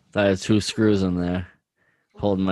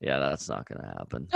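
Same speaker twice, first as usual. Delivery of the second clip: low deadpan; 6 semitones lower, 8 dB quieter than usual. Retold or imitated. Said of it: "he was like".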